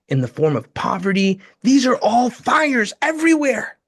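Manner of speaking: preacher climbing to a fervent high pitch; impassioned preaching build. Real, not acted. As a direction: build the list of fires to a passionate peak. Builds an impassioned sermon-like appeal.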